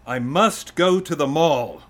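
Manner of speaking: loud, high, slow and emphatic; melodramatic demand. Acted. Son demanding a ride from his father, melodramatic. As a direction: make it a life-or-death plea, comically.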